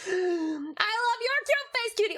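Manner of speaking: high, slow gushing coo. Real, not acted. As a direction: gush over something adorable. Cooing at viewer.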